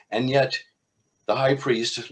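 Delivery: usual speaking voice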